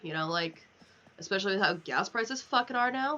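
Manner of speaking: ordinary delivery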